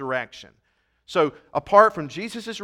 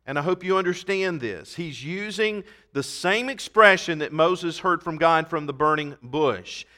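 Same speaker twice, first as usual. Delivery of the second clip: ordinary talk, nothing marked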